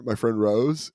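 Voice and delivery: usual speaking voice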